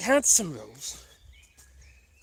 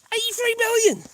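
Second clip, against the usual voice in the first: astonished; 13 semitones higher, 3 dB louder than usual. Real, not acted. Stunned at the follower count.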